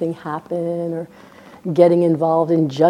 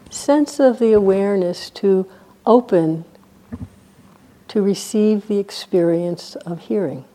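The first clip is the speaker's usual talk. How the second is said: guided meditation. Real, not acted. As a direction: guide them into stillness, leaving silence between phrases.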